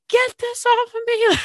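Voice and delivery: very high squeal, imitating panic; panicked mock cry. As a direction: yelp it like someone trapped and desperate. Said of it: "he's just like".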